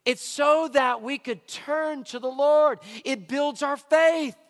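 preacher driving the lesson home; slow emphatic point. Real, not acted. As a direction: land the point like a verdict.

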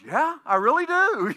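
high, loud, satisfied mobster; Robert De Niro. Retold or imitated. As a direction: blurt it out, relieved after the shots. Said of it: "He goes".

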